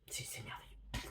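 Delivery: barely audible whisper of wonder; hushed, voiceless rapture; awed whisper. Real, not acted. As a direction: whisper it, lost for words with admiration. Overcome with praise for the book.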